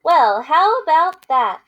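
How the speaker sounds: lively picture-book read-aloud with character voices; reading to children. Acted. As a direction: read it to kids, playing both friends. Teacher reads picture book aloud.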